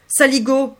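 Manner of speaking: loud, hammed-up indignant insult; exaggerated mock fury; mock outrage. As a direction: bark it in fake disgust. Feigned outrage at kiss.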